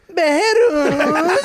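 high, loud laughing Behruz call. Retold or imitated. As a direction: shout the name like a passer-by. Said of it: "min mamma skriker mitt namn". (högt skrattande rop Behruz)

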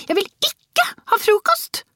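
put-on very high, furious little girl's voice; shouting child. A narrator's reading. Girl rises raging at breakfast, high childish shout. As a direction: tantrum cry in a small child's pitch. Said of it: "ropte hun".

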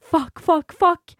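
panicked swearing. Retold or imitated. Panic after creepy video.

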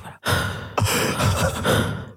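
drawn-out breathy intake, no voice; long shocked gasp. Retold or imitated. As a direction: gasp slowly as if acting out shock. Acts out her frozen shock.

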